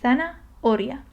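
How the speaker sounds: "Zanahoria." split as thana, oria, H unspoken; silent H. Shown as a demonstration. Teacher pretending the H is not there, "Zanahoria." as thana, oria. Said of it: "pretend like it's not there and you go on".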